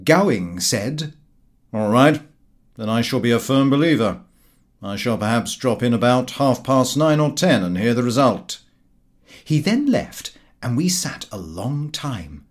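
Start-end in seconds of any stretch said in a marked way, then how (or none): 1.7-8.6 loud, blustering know-all; a self-satisfied friend laying down a challenge; Gowing's brash voice. Acted. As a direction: announce it like a cocky sceptic.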